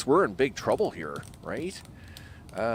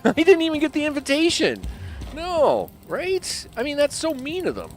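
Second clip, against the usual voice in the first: much higher mock-hurt voice; mock offended; 13 semitones higher than usual. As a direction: pretend to be snubbed, high and whiny.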